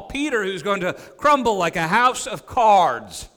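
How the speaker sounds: ordinary talk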